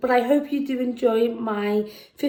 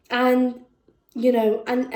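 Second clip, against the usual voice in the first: ordinary talk, nothing marked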